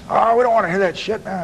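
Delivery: high-pitched protest from the back; heckling inmate. Retold or imitated. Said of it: "guys in the back".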